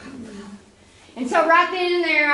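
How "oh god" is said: very soft oh God under the breath; barely voiced dread; dreading whisper. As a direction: dread at mom's look. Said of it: "You're like".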